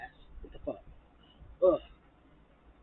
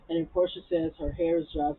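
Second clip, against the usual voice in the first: normal talking voice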